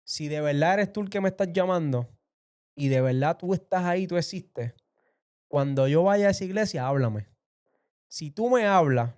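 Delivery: reenacted fervent plea aloud; retold prayer aloud. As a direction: call up to God as if testing Him. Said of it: "hablé en voz alta y dije".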